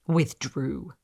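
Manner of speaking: plain reading voice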